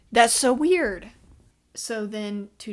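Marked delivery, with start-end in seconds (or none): 0.1-1.1 louder, higher, slow disbelieving exclamation; incredulous. Real, not acted. Reviewer reacting to the twist, loud and disbelieving. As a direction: stretch it out, even more baffled.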